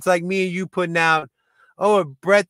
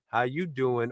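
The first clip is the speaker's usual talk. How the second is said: Joey "How you doing"; 4 semitones lower, 7 dB quieter than usual. Retold or imitated. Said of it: "Joey from Friends".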